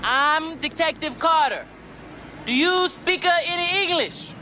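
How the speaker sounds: Detective Carter yelling at a man who won't answer; Rush Hour catchphrase. Acted. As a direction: bark each word slowly. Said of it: "famous catchphrases from Rush Hour".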